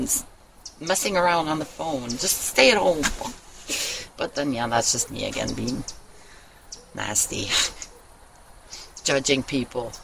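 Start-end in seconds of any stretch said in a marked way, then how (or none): 4.2-9.9 sheepish quiet aside. Real, not acted. Self-deprecating aside.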